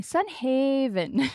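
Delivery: brother's "Sun Haven", stretched slow and pitched high; imitated drawn-out Sun Haven. Streamer quoting brother's picked-up habit, drawn-out Sun Haven. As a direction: mimic "Sun Haven" as a slow, lilting catchphrase. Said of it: "I had him saying".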